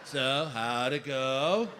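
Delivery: low, slow stoner drawl; pot store clerk. Retold or imitated. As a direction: play a mellow, unhurried dispensary guy.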